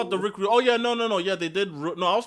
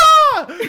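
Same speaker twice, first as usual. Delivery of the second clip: very high burst of laughter; loud laughing shout; 9 dB louder than usual. Real, not acted. Cracking up at the Spanish comeback, loud. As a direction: howl with laughter.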